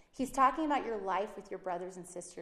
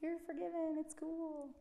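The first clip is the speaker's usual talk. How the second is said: higher, soft and carefree dismissal; breezy mock casual; 7 semitones higher, 6 dB quieter, slower than usual. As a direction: toss it off lightly, no big deal. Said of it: "New Testament is all".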